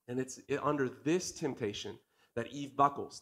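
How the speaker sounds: ordinary talk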